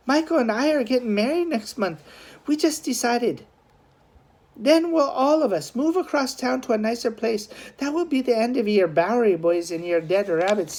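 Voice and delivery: put-on high, pleading young woman's voice; sister Noreen. A narrator's reading. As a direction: voice the sister, firm and hopeful.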